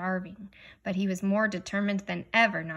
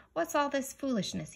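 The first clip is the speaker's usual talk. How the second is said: demanding; 6 semitones higher than usual. Acted. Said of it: "he demanded".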